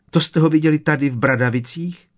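high, fast, loud elf question; agitated house-elf. A narrator's reading. Winky asks whether they saw him at Hogwarts, breathless and high. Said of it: "vyhrkla Winky bez dechu".

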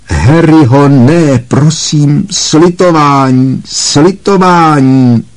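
high, slow, drawn-out cries for mercy; woman shrieking for mercy as she falls; screaming plea. Acted. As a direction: plead for mercy, dragging each word. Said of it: "žena ječela".

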